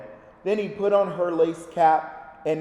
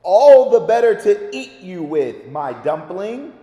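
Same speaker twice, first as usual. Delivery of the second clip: triumphant wolf; 2 semitones higher, 9 dB louder than usual. Acted. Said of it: "laughed the wolf".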